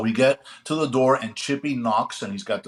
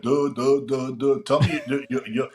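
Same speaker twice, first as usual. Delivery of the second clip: mock stutter. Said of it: "Chippy does his imitation of stuttering".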